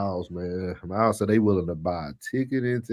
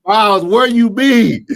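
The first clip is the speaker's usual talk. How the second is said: loud, slow, higher call across the room; 11 semitones higher, 10 dB louder, slower than usual. Real, not acted. Co-host playfully hollering for Miles, loud and drawn out. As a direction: stretch it out and call him over, much louder.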